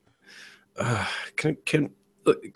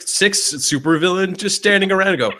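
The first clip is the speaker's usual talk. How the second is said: ordinary delivery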